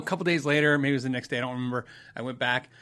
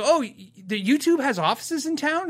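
high-pitched mimicked astonishment; imitated naive surprise, pitched well up; amazed; 9 semitones higher than usual. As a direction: ask as if astonished by the news. Imitates worker's amazed follow-up question.

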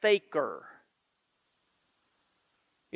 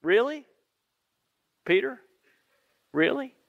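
sceptical, rising one-word questions, long pauses between; doubting Jesus; 4 semitones higher than usual. Acted. Jesus doubting Peter's bold promise, sceptical. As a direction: question it in mild disbelief, letting each word hang. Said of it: "this is what Jesus says".

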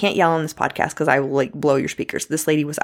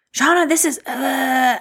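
high, held-back imitation of yelling; mock irate caller; 9 semitones higher, slower than usual. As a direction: imitate a furious caller, high and dragging into a growled blur. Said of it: "this lady was so irate".

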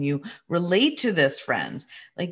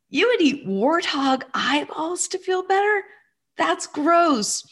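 much higher, playful grossed-out mother; mock disgust; 12 semitones higher than usual. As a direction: tease him with a mock-horrified question. Mother teasing son playfully.

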